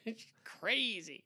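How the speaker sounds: high, slow "crazy"; playful slow singling-out of the word; drawn-out teasing. Pretend. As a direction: stretch out "crazy" in mockery. Teasingly repeats friend's words about wife.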